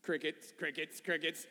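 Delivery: low, soft little aside; deadpan awkward silence. Acted. Preacher met with silence after a question, soft deadpan filler. Mocking the congregation's silent response.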